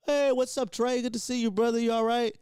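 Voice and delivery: upbeat retold manager's hello; hearty greeting. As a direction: greet an old friend with energy.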